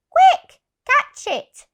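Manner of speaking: very high, loud urgent cry; shouted. Acted. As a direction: shriek for help catching the cat. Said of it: "shouted the nurse".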